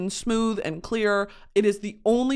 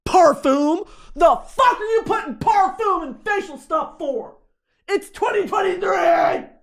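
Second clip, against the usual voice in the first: much higher and louder, slowing to a yell; mock furious rant; 8 semitones higher, 3 dB louder than usual. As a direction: rant like a fed-up customer. Ranting at the brand.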